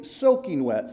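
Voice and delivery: ordinary delivery, nothing marked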